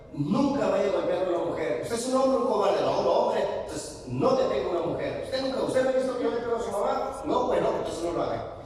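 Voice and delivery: father's stern advice. Retold or imitated. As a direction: lay down the law as the father.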